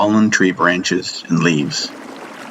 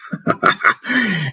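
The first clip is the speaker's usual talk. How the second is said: a sudden high burst of laughter; loud laugh; 14 semitones higher than usual. A narrator's reading. Master laughing at his student's blindness. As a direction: let the laugh jump up high. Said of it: "He laughed loudly".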